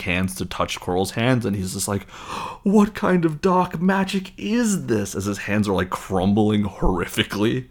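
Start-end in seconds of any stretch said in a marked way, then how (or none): 2.6-5.2 high, slow mock horror; hammed-up villain panic; horrified villain. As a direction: wail in theatrical dismay. Quirrell horrified by his hands.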